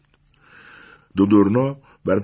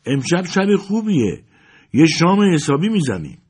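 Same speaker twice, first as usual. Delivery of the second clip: loud over the engine; 9 semitones higher, 5 dB louder than usual. Acted. Wife calls to husband over the car engine, raised voice. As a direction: call out cheerfully above the roar. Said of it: "با صدای بلندی گفت".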